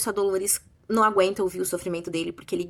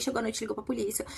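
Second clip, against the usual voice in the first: ordinary talk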